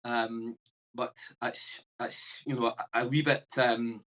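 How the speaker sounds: light demo of a Scottish accent; hesitant, out-of-practice Scottish accent. Shown as a demonstration. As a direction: talk with a slightly rusty Scottish accent. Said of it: "this is my Scottish accent".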